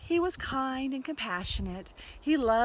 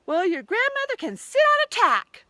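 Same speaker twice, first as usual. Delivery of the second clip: high, loud taunting pig; rude retort; 12 semitones higher, 9 dB louder than usual. A narrator's reading. Pig taunting the wolf about grandmother, high, loud and rude. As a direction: sneer the insult.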